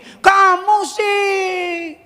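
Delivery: slow, high reproachful drawl; blaming tone. Retold or imitated. Father blaming crying son, slow high reproach. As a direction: pin the blame on the child. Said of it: "Saya nggak bilang gini".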